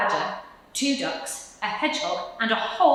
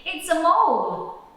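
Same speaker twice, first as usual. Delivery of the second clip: amused reveal; 10 semitones higher, 6 dB louder than usual. Acted.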